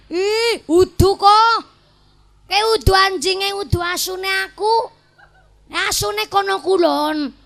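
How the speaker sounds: high, loud quick denial; unoffended neighbor. Acted. Neighbor denies owning the dog, high and unoffended.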